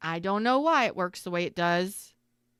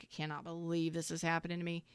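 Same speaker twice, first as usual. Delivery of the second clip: despairing mutter; 2 semitones lower, 10 dB quieter, faster than usual. Retold or imitated. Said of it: "and I'm like".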